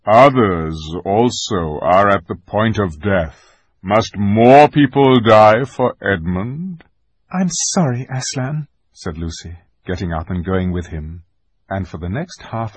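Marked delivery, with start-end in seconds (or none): 0.0-6.8 slow, loud, weighty reproach; graver voice. A narrator's reading. Aslan reminds Lucy that others are dying, grave. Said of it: "said Aslan in a graver voice". 7.3-8.7 put-on higher, apologetic child's voice; contrite girl. A narrator's reading. Lucy apologises to Aslan after his rebuke, contrite.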